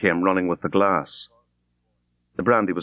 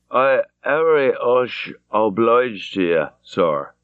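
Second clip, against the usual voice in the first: slow, slurred thanks; thick drunken slur; 3 semitones higher, slower than usual. Acted. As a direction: thank him through a drunk, heavy tongue. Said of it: "He spoke very thickly".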